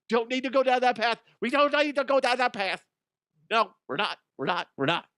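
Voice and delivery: high, loud, no we're not; loud shutting down. Real, not acted. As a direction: shut the topic down firmly. Cutting off religion talk.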